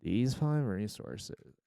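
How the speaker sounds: lightly imitating a tutorial host, drawn out; Minecrafter imitation. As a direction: try out a measured Minecraft tutorial voice. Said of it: "I need to hear an example".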